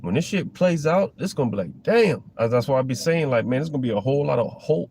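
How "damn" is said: stunned damn. Pretend. Imagined reaction to prophecy unfolding.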